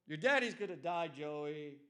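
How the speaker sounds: put-on dad breaking news to his child; father addressing son. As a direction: announce it to your little boy.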